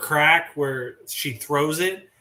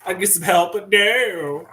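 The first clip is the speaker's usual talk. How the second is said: Tamatoa voice; 6 semitones higher, 5 dB louder than usual. Retold or imitated. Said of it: "He's like".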